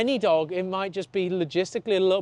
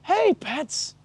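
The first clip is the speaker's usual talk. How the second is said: sing-song, much higher greeting to the dog; theatrical welcome; 9 semitones higher, much slower than usual. Shown as a demonstration. Trainer warmly greeting the sulking dog in a much higher, theatrical voice. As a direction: overplay delight at seeing her. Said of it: "we're, like, theatrical with it".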